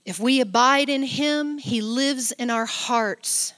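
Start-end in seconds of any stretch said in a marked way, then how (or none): none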